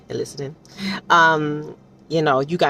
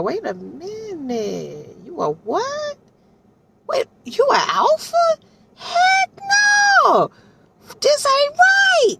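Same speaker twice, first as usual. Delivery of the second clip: shocked disbelief; slower than usual. Retold or imitated. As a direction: start puzzled, climb into an outraged scream. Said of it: "so he was like".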